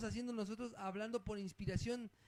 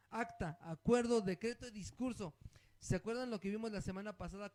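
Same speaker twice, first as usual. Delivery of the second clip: ordinary delivery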